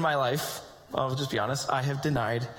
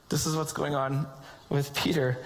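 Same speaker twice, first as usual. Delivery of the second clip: usual speaking voice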